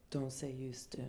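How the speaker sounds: hushed, whispered command; intense whisper. Retold or imitated. New acquaintance urging her about comedy, intense whisper. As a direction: drop to a whisper and insist. Woman solemnly urges her on.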